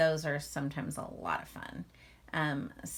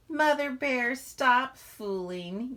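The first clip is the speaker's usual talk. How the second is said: playful scolding cub; 7 semitones higher, 6 dB louder, slower than usual. Acted. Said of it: "he said".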